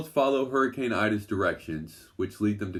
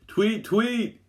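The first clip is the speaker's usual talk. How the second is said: much higher, louder urgent chirp; frantic bird call; 5 dB louder than usual. A narrator's reading. Louisa calls a bird frantically as a polar bear charges, much higher chirping. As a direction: chirp desperately like a bird in danger. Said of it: "she calls frantically".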